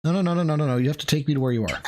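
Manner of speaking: ordinary talk, nothing marked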